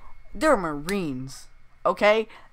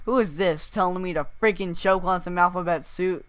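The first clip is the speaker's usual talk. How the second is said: usual speaking voice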